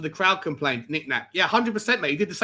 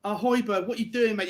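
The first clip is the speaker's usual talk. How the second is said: usual speaking voice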